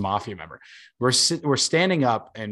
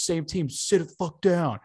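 higher, pushy heckler snarl; mock angry order; 6 semitones higher than usual. Heckler telling the guys to sit down, higher mock angry order. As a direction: shove the words at them like a rude fan.

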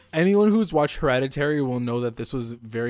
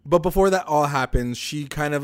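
ordinary delivery

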